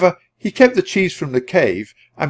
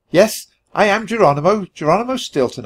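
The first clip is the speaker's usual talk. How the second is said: shouting; 7 semitones higher than usual. Acted. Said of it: "I shouted".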